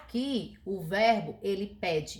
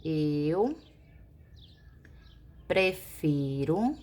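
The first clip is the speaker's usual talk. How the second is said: Eu held, prefiro slow; slow model phrase; 3 semitones lower, much slower than usual. Shown as a demonstration. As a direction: dictate the correct start slowly. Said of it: "Fica assim, ó".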